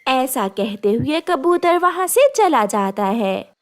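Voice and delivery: plain reading voice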